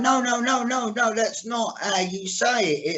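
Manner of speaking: mock a teacher's string of protests; lightly acted voice-teacher correction; imitated voice teacher. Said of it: "She was going".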